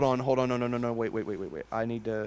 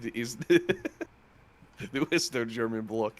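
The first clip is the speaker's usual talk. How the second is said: put-on Russian comrade accent through laughter; laughing while doing a Slavic accent; 3 dB quieter, slower than usual. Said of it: "My Slavic accent was better than yours".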